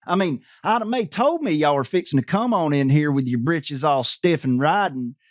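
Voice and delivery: ordinary talk, nothing marked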